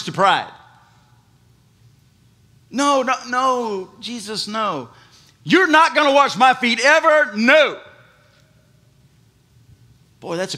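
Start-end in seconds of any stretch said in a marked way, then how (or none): 2.7-7.8 loud, high repeated no; proud refusal. Retold or imitated. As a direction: refuse Jesus flatly and loudly. Peter's proud refusal acted out.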